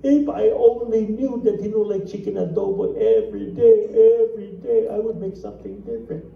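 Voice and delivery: hammed-up regret, easing off at the end; mock lamenting widow. Filipino widow in a joke at her husband's funeral, mock lamenting. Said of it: "go to the Filipino lady".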